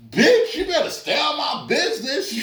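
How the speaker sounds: put-on high, defiant kid snapping back; mock-tough kid retort. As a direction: talk back hard for the boys. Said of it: "I was like".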